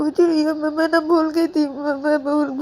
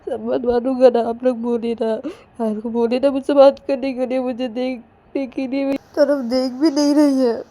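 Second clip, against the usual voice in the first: high, louder crying complaint with stammered repeats; acted tearful pleading; 6 semitones higher, 5 dB louder than usual. Ananya begging mothers to talk Payal round, tearful. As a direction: plead through sobs, whining to the grown-ups. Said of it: "रोए जा रही थी बहुत ही।".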